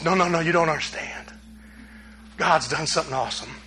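low, hushed acted reassurance; gently calm someone down, keeping it low; soft, low voice of a wife breaking news. Wife softly reassuring in retold scene.

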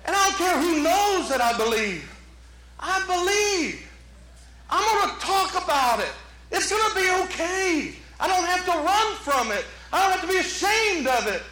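high, impassioned profession of faith; bold declaration. Acted. Acts out bold confession of faith.

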